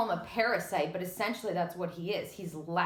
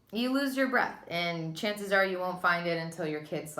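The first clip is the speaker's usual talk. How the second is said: normal talking voice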